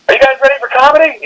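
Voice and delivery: high, quick hype-man pitch; mock hype emcee. Host introducing comedy after the flood losses, hyped. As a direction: pump up a crowd that isn't ready.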